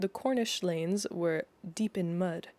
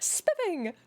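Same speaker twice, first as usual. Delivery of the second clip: much higher, laughing through the word Spiffing; laughing exclamation; 13 semitones higher than usual. Acted. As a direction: giggle out Spiffing in delight. Belle repeats it, laughing.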